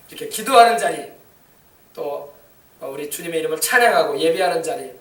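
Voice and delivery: ordinary talk, nothing marked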